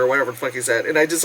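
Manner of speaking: normal talking voice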